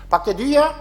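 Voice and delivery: high, loud Jeolla mother-in-law calling through the door; Jeolla accent. Retold or imitated. Mother-in-law calls through the door in Jeolla accent. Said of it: "전라도 분이잖아요".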